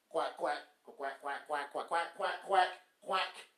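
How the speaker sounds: low mock duck quacking; repeated mocking quacks. Mocking ducks.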